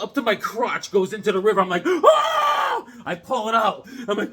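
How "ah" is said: very high, loud retold scream; long shriek of icy shock, played up; mock shriek. Kid's leg goes into the frozen river, mock shriek.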